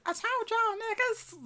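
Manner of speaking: high, slow mocking echo in a put-on voice; mock squeaky echo. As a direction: parrot her line back in a silly high voice. Echoes friend in put-on voice.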